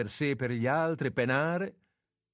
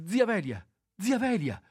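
high, loud calling out; calling loudly; 10 semitones higher, 4 dB louder than usual. Acted. Groggy nephew calls his dying aunt, loud and high. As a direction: call the old aunt, still half asleep. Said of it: "chiamava intanto il giovanotto con voce grossa".

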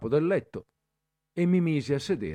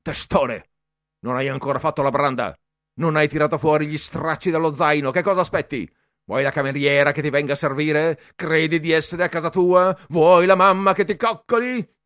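loud, higher mean tirade, building; nasty yelling; 5 semitones higher, 8 dB louder than usual. A narrator's reading. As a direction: berate him, harder each line. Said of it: "divenne cattivo". (Bertoli aggredisce il ragazzo, sempre più forte e cattivo)

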